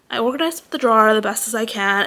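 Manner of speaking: normal talking voice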